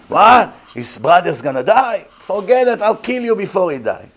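high, loud outburst then menace; outraged threat. Retold or imitated. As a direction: threaten the doctor over his dying brother. Relative threatens doctor.

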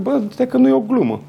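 high, excited realization; suddenly realizing it's no joke; retold excitement. Retelling to the host the moment the call proved real, excited and high.

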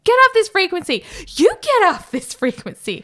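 loud, high mock outrage; imitated radio quarrel. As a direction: yell it back over the radio. Acting radio quarrel with air traffic.

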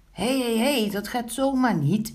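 loud, high scolding; indignant protest. A narrator's reading. Pinkeltje scolds Wiebelstaartje for taking the pancake, high indignant protest. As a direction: object to the cheeky theft.

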